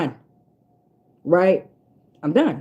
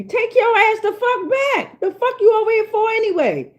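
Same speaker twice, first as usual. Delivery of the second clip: shrill mock-outraged voice much higher, pushed up; high mocking squeal; 11 semitones higher than usual. Mocking back at bragging foreigners, in a high squeal. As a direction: squeal it back at them in scorn.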